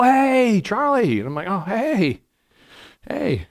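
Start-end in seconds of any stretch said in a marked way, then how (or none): 0.0-1.2 high-pitched, drawn-out hail; imitated greeting call. Imitating people hailing him in stores. 1.5-3.5 high, drawn-out polite hey; awkward greeting. Retold or imitated. His own reluctant reply to greeters.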